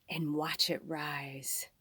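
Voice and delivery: soft unvoiced whisper. Reader whispering the spirit's promise. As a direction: let it fade into a breath.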